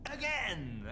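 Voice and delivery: high, loud Again; mock energetic call; eager shout. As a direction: demand another run eagerly. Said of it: "he's like".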